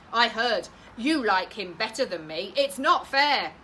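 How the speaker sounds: put-on loud, whiny girl; girl protesting to mum, loud; sulky child. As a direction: complain like a jealous child.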